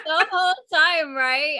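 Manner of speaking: high and loud, gushing; excited. Real, not acted. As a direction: burst out with delight. Excited, laughing after reading.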